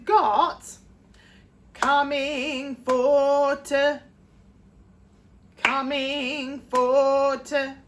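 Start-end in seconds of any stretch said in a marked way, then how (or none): 1.7-7.8 sing-speak "coming for to", punching "ing" and "for"; stress on ing and for. Shown as a demonstration. Teacher demonstrating the rhythm to singers, slow and stressed. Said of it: "the ing and the for are basically your stress".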